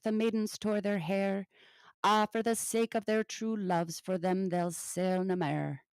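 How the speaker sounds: measured, rhythmic ballad reading; poem recital. Acted. Reading an old Scottish ballad aloud to the class, in a measured poem recital. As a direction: let the old verse roll in its own beat.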